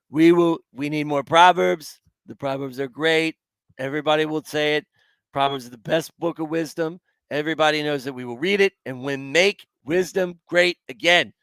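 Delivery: badly faked politician's rally voice; terrible Trump accent. Shown as a demonstration. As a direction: do a hammed-up presidential impression. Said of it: "That's my best terrible Trump accent".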